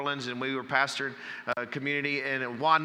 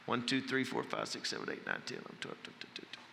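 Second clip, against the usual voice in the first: counting that fades from soft down to a whisper; counting fading out; 3 semitones lower, 10 dB quieter than usual. Retold or imitated. Shepherd counting his sheep at night, fading into a whisper. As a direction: let the count drift off into a whisper.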